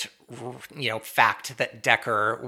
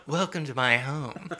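mock Southern accent. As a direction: imitate a bad Southern hostess. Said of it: "that Southern accent was one of the worst".

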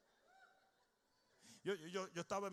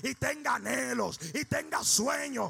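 shouted, rising sermon climax; full-force preaching; 8 semitones higher, 11 dB louder than usual. Acted. Pastor shouting blessings over his congregation, full-force preaching. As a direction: preach it at the top of your lungs.

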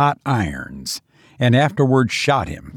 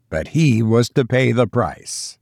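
usual speaking voice

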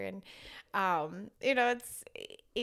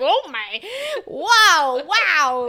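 loud, higher gasps of wow; imitating toddler amazement; 7 semitones higher, 15 dB louder, much slower than usual. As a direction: marvel like a wide-eyed toddler. Toddler reacting to wild driving.

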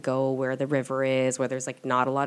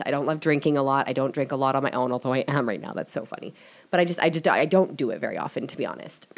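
normal talking voice

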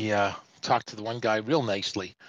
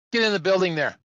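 mock barked order; 6 semitones higher, 5 dB louder, faster than usual. Acted officer ordering trucker inside.

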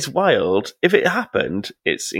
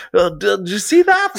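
high, loud startled shout; high, loud mock yelp of surprise; shouted quote; 10 semitones higher, 4 dB louder than usual. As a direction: blurt it out like a caught culprit. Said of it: "he's like".

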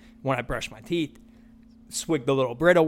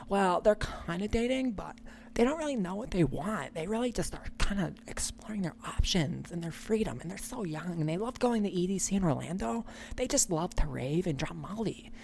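fake Valley Girl accent; 3 semitones higher, 10 dB quieter than usual. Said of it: "fake Valley Girl talk".

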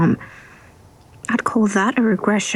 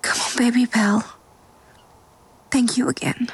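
whispering. Acted. Whispers throughout.

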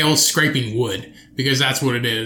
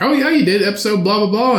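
mocking friends; 5 semitones higher than usual. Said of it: "And they'll be like".

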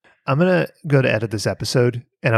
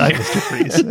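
laughing; 14 semitones higher than usual. Acted. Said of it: "laughing through the line".

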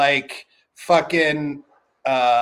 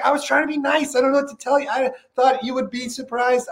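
high, pleading excuse; mock defensive whine; 7 semitones higher than usual. Answering angry Maddie, high defensive whine. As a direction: plead innocence in a raised voice.

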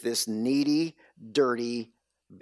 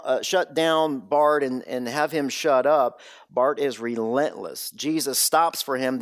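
usual speaking voice

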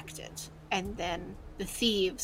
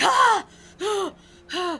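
high startled cries as he jerks awake; startled yelps; 12 semitones higher, 12 dB louder, slower than usual. Acted. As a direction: gasp awake in shock. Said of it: "and he's like".

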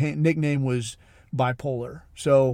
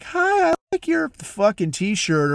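mock flirty woman; 7 semitones higher, slower than usual. Women flirting with bipolar guy.

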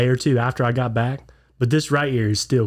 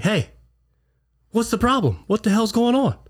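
retold confrontation; 10 semitones higher than usual. Confronting man at the door.

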